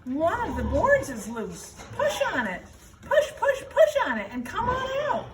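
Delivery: bossy goose. Acted. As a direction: push him on like an eager goose. Goose voice in story.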